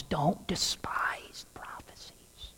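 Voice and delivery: slow, breathy whisper; hushed quotation of Paul. Acted. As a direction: lean in and hush it.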